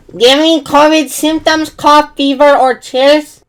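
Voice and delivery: loud, high mock questioning of the patrol; mocking ski patrol. Patrol asks the injured skier about covid, in a loud high mock voice. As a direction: ham up the patrol's silly checklist.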